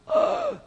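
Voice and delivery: high, sudden acted gasp; mock gasp. Peter finding himself on the water, astonished gasp. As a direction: suck in air in amazement.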